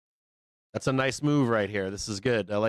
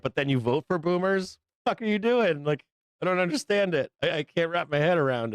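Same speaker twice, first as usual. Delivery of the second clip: exasperated; 6 semitones higher than usual. Real, not acted. Baffled rant about voting boomers.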